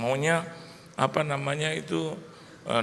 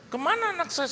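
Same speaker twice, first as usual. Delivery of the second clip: mock-panicked, high-pitched demand; retold panic; 13 semitones higher than usual. Father demands his missing newborn from the nurses, panicked. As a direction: voice leaps up in alarm. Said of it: "Saya panik".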